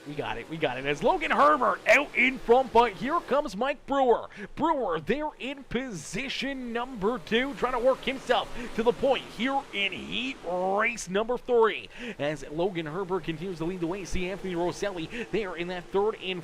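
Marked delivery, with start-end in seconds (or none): 0.9-11.9 loud, high, driving race call; live play-by-play. Real, not acted. Announcer calling the green flag and first pass, excited play-by-play. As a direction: call the restart at full force.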